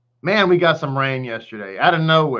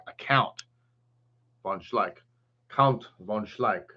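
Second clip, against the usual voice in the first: lightly voiced lower noble count; 4 semitones lower, 6 dB quieter than usual. Teacher pretends to be a grand count, lightly lower and slow. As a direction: say it low and slow like a mock aristocrat. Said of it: "I felt like a, a count".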